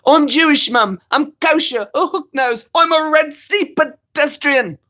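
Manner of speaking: high, slow mock indignant; lightly voiced indignant son. Quoting [name] to his mum.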